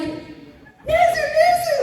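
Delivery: very high, loud squealed name; fan calling out. Retold or imitated. Fan spots the cosplayer at the con, high excited yell. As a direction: holler the name in a piercing high pitch.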